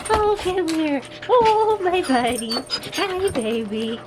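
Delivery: high, gushing dog-greeting voice. Real, not acted. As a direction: coo over the dogs, delighted. Greeting dogs in baby talk.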